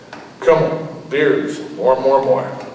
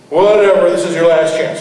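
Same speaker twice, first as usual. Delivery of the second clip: loud brush-off; 5 semitones higher, 6 dB louder than usual. Acted. Angry landlord threatens tenant.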